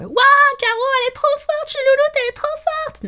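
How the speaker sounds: cri de fan aigu et rapide; s'extasier en groupie surexcitée; fan hystérique. Pretend. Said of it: "de crier". (cri aigu de fan en délire)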